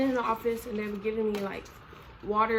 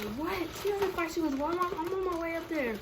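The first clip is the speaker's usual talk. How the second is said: high-pitched, flustered mock disbelief; shocked mom; 7 semitones higher than usual. Mom on the phone with school, mock shock. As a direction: imitate a shook mother rushing over. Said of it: "my mom was shook".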